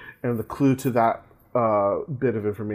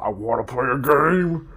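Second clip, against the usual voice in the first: Jigsaw. Retold or imitated. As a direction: put on the game master's menacing voice. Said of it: "cued the Jigsaw".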